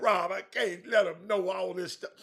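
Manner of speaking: imitating a friend.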